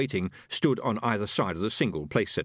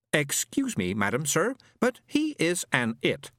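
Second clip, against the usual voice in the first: slow, higher booming machine voice; booming robot; 6 semitones higher than usual. A narrator's reading. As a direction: announce it like a pompous robot. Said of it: "boomed the robot".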